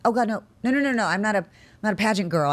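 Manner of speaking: high, rushed string of refusals; mock alarmed protest. Winner told she advances, mock alarmed protest. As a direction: wave it off in comic dismay. Retold refusal of next pageant.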